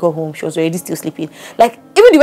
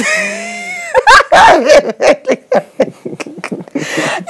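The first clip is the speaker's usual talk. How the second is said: big laughing shout, high. Real, not acted. Laughing after imitating daughter.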